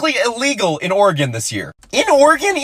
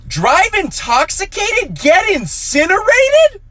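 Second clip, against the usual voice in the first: confused; 8 semitones higher, much slower than usual. Retold or imitated. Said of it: "And she goes".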